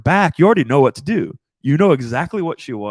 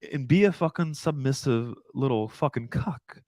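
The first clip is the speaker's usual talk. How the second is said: ordinary talk, nothing marked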